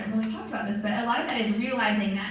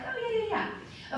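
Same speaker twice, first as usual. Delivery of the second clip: high, soft fake-eager yeah; mock eager agreeing; 12 semitones higher, 5 dB quieter than usual. Recruiter pretending to listen to a prospect, high and chirpy. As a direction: chirp along like you're listening. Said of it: "you're like".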